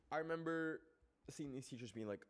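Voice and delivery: normal talking voice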